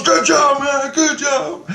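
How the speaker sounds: high, drawn-out cheer; retold high, hearty shout; shouted congratulations. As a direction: call out praise from across the way. Door keeper cheers him on.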